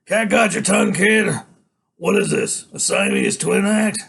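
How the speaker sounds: mock the kid at full volume; taunting biker boss. A narrator's reading. Biker pokes a kid in the chest, loud taunting.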